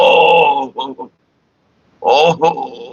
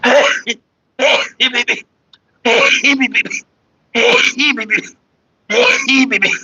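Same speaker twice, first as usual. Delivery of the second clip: high cooing call; 10 semitones higher, slower than usual. Real, not acted. Cooing repeatedly at someone.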